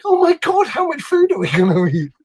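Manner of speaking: high-pitched mock-overwhelmed exclamation; retold disbelief. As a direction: start very high, as if replaying your shock.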